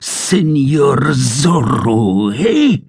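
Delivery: Señor Zorro drawn out slow, then a sharp high eh; contemptuous taunt, eh flung upward; mocking repeat. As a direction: sneer the name, snap the eh up high. Sergeant repeats name mockingly.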